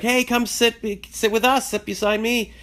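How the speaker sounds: imitating Lane calling her over; teen girl's eager invite. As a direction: squeal it like an excited teenager. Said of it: "her daughter Lane is like".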